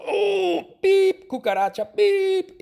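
very high, loud squeal of panic; panicked shriek at cockroach. Retold or imitated. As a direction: shriek like you just spotted a cockroach. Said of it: "yo grito". (grito agudo de pánico)

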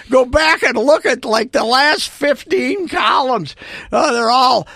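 mock shouting.

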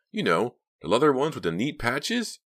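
high, loud, eager voice; swooping high with enthusiasm; enthusiastic. A narrator's reading. Young robber describing the jacket he wants, enthusiastic. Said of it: "Mickey said with enthusiasm".

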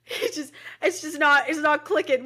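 tearing up while talking about grandpa; choked up. Real, not acted. Talking about grandpa's fading memory.

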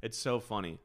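ordinary delivery

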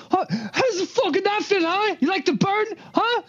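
high, furious taunting yell; enraged gloating shout at a burned captive; angry yelling. Acted. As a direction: scream mockery into the burned man's face. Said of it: "and then he yelled".

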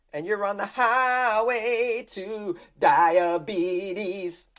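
drawn-out ominous announcer voice; slow dramatic drawl. Acted. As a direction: stretch it out like a movie trailer.

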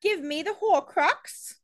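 high, slow mock British villain; exaggerated bad British accent; Harry Potter British. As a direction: draw it out in fake British. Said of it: "The only thing I know from Harry Potter".

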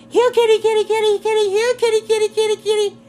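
very high, loud sing-song call; calling kitty. Retold or imitated. Wife calling the neighbor's kittens, high sing-song call. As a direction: chant it across the yard to the cats.

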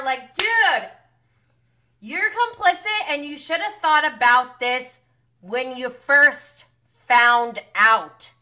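loud, high-pitched indignation; exasperated scolding. Real, not acted. Recapper berating the complicit friend, exasperated.

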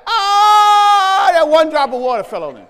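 high, loud, crying wail of a mocked devil. Preacher mocking the TV devil's cry, loud hammed-up wail. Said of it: "And he was down there like".

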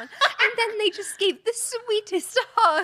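sweet, high, sing-song princess voice; dreamy cartoon princess lilt; Disney princess voice. Real, not acted. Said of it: "your Disney princess voice came on".

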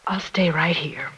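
a tired whisper that insists on staying; whispered refusal. Acted. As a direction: whisper it, slow and drained. Worried mother refuses to leave.

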